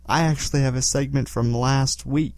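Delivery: ordinary talk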